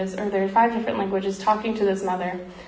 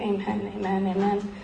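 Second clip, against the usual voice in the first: mild muttering; 6 dB quieter than usual. Retold or imitated. Prisoners answering the doctor's prayer, softly muttered amens. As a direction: murmur the amens like a huddled crowd. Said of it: "like, muttering".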